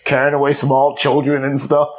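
ordinary delivery

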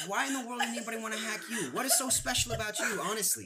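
high, loud, fast mock disbelief, laughing through it; laughing incredulity. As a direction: laugh while scoffing at him. Retelling the confrontation, laughing.

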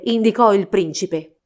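ordinary delivery, nothing marked

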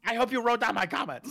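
high, loud put-on complaint; hammed-up indignant voice; mock indignant customer. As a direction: play the offended customer. Co-host mocks caller.

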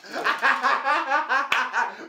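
big real burst of laughter, high and loud; sudden belly laugh. Real, not acted. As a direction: crack up loudly at the joke.

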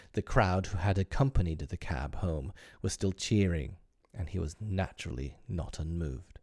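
plain reading voice

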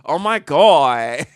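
a light [name] drawl. Acted. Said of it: "I sound like [name]".

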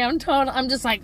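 ordinary talk, nothing marked

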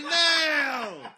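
high, loud drawn-out wail of no; comic cry of dismay; dismayed yell. Retold or imitated. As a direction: holler it long and high. Panics as cops follow him over.

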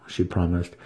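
usual speaking voice

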